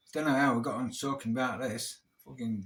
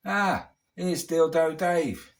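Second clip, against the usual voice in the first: slow, drawn-out taunt, louder and higher; mocking coworker; 5 semitones higher, 8 dB louder, much slower than usual. A coworker taunting him in front of everyone, slow mocking jeer. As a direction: sneer it out like a workplace bully greeting you.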